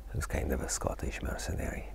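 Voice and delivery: Scottish mercenary. Retold or imitated. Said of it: "talks a bit like that".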